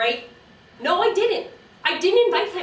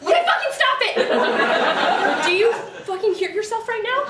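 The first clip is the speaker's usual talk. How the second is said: loud, higher angry shout; exasperated outburst; 6 semitones higher, 5 dB louder, slower than usual. Acted. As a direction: cut the gushing off furiously. Friend snaps at the dog voice.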